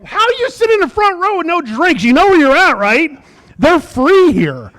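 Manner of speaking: high, incredulous holler at the crowd; mock outrage. Comic to a front row without drinks, mock outrage. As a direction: stretch it out, high and loud, in mock disbelief.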